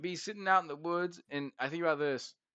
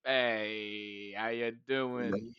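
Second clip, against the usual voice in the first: lazy Bigfoot voice; 6 semitones lower, 5 dB quieter, much slower than usual. Retold or imitated. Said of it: "he'd be like".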